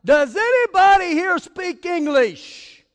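high, drawn-out acted shout; fed-up yell. Lost preacher appeals to Juarez locals, exasperated yell. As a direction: yell it out slowly to the whole street. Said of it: "I yelled out".

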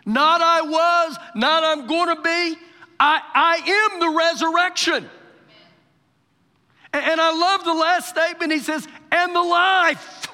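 ringing, emphatic pulpit shout; full-force preaching. Real, not acted. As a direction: proclaim it to the whole congregation.

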